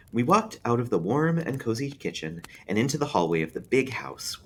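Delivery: usual speaking voice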